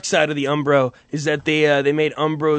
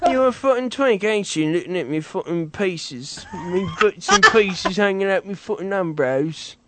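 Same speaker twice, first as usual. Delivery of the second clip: mock British accent; 2 semitones higher than usual. A lightly put-on British lad jeering about his bits and pieces. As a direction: taunt in a cheeky British accent. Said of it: "I don't do the voices".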